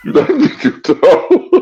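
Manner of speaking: laughing high through the quote. Real, not acted. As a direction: giggle the line out. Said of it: "laughing while repeating".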